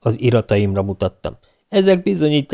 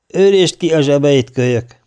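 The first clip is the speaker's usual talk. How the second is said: loud, brusque order from a sergeant; gruff, rough; 5 semitones higher, 5 dB louder than usual. A narrator's reading. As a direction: order the kid about roughly. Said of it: "mondta nyersen az őrmester". (nyers, ráförmedő parancs)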